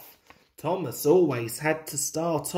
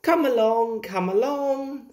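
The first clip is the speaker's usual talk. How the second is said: higher, lilting encouragement; lightly sung, higher call; singsong call; 6 semitones higher, 3 dB louder than usual. A narrator's reading. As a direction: sing it out lightly to those behind. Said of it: "sang Thomas".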